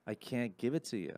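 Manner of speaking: regretful teacher. Retold or imitated. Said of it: "she was like".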